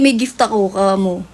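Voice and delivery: ordinary talk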